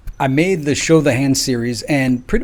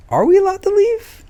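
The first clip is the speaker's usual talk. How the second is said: much higher, urgent question; voicing Americans. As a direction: ask it like a whole nation demanding an answer. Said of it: "Americans start to say".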